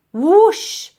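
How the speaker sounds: high, loud and slow, trapped under a sheet; yelled. A narrator's reading. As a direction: bellow it out, drawn-out and high. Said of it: "he yelled from beneath".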